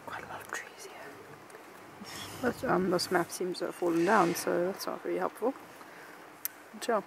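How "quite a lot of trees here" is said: soft whispered aside. Real, not acted. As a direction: whisper it under your breath.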